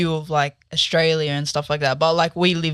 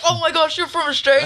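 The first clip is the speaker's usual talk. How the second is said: high-pitched mock amazement at a foreigner; excited mock reaction; 11 semitones higher than usual. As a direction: pounce on it, imitating an overexcited fan. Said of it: "pounce, be like".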